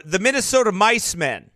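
ordinary talk

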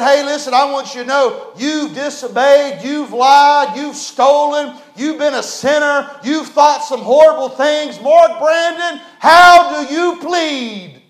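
loud, high, slowing preacher's indictment; judge's stern charge. Acted. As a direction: thunder each charge, then drag out the plea. God-as-judge in sermon.

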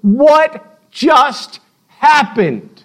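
slow, high, aghast; drawn-out bewildered cry; stunned disbelief. Retold or imitated. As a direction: wake up and not believe it. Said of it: "the indignation and the consternation".

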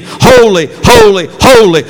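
ringing, loud and high; choir's cry. Retold or imitated. Angels in heaven crying out praise, loud and ringing. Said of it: "the angel choirs in heaven sing".

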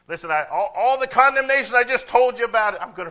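preaching shout. Acted. Voicing God, rising to a cry.

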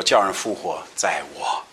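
low, soft and solemn; Jesus speaking. Acted. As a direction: speak it gravely and gently. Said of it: "耶稣对他说".

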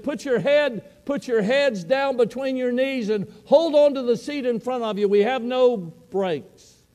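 high, loud, desperate order to the team; urgent shouted warning. Retold or imitated. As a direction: bark the order over the runaway bus. Coach warns players as bus crashes.

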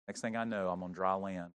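usual speaking voice

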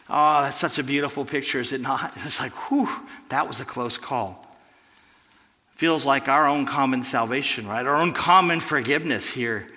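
ordinary talk

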